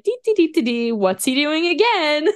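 high, sing-song oblivious voice; imitating Tighnari. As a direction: hum along, then get fussy. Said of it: "Tignari's probably like".

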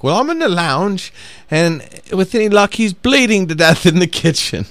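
high, breezy British lady impression; chill British lady. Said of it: "she's just chill as, as all can be".

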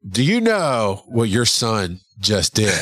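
slow, accusing question, word by word; imitating stern wife. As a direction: draw it out like an unamused spouse. Upset wife confronts him by phone.

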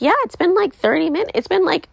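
high-pitched, imitated justification; retold defensive reply. New mother answering nurses about feeding, high defensive reply. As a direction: pitch jumps way up, mock-defensive.

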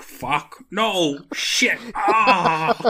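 high, loud hammed-up cursing; mock upset screaming. Podcaster mimics old man as girl escapes, mock screaming. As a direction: wail curses in comic dismay. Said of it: "the old man starts screaming".

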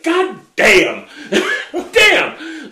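loud, high laughing exclamation; retold shocked exclamation. Retold stunned reaction, laughing.

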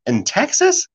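high, incredulous in Texas?; surprised. Retold or imitated. Asking a friend about Texas, surprised. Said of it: "I said".

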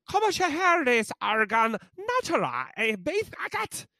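very high-pitched botched Gaelic ad read; mock Irish Gaelic accent. Host reading a beer ad to Irish listeners, mock Gaelic accent. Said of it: "to do an ad in".

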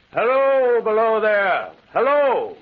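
high, loud hail down to the tracks; crying out. Acted. [name] hails a stranger down by the tracks, loud call. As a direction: call out to the stranger far below. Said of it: "I cried".

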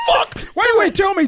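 high, loud outraged protest; retold angry shout. Teller replaying his shout at his uncle, outraged and loud. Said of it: "And I'm like".

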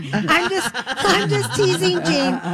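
laughing tease. Real, not acted. Host laughing as she teases donor Jane, light and giggly. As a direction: giggle it out while teasing.

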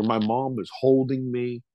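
ordinary delivery, nothing marked

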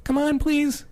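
put-on high, pleading kid voice; pestering child. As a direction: beg like an annoying little kid. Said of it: "Gee, Max".

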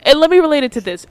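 high, hurried bid for the floor; retaking the floor. Real, not acted. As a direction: push in loudly to get heard. Retaking the floor after crosstalk.

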